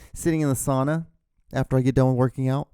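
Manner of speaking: ordinary talk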